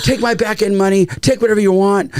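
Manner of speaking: high-pitched, worked-up retort; imitated defiant outburst. Director offers studio his back end money, imitated defiant outburst. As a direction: mimic a director throwing it all back at them.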